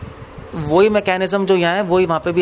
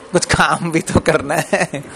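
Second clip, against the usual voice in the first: slow, laughing mock complaint; laughing protest; slower than usual. Boss to a servant who only praises him, laughing protest. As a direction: stretch it out through a chuckle.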